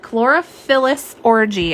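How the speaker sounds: Chlorophyllus Orgy spelled out slowly, syllable by syllable; carefully slow so as not to trip on Chlorophyllus; slow, deliberate pronunciation of a tricky name. Shown as a demonstration. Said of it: "I have to say it slow".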